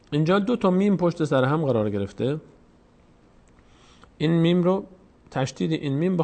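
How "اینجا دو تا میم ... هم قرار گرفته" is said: ordinary talk, nothing marked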